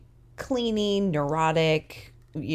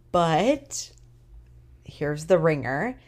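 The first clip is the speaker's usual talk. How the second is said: normal talking voice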